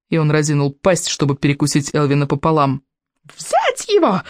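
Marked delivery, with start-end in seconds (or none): 3.4-4.2 high squealing order; shrieking. A narrator's reading. Alvin to his warriors, a high shrill shriek. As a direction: screech the command. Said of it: "взвизгнул Элвин".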